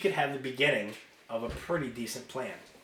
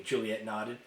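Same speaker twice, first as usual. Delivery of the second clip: ordinary delivery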